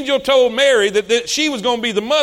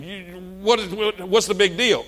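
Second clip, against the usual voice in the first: 1 imagined skeptic; 3 semitones lower, 5 dB quieter than usual. Pretend. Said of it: "I know what you're thinking".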